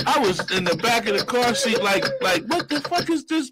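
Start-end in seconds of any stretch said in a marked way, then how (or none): 2.4-3.5 high-pitched mock bewilderment; baffled inner voice. Passenger to himself in back seat, high bewilderment. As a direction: question it in a high, incredulous voice. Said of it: "what I'm saying to myself".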